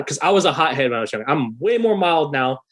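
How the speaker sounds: usual speaking voice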